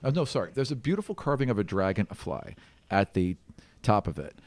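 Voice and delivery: ordinary talk, nothing marked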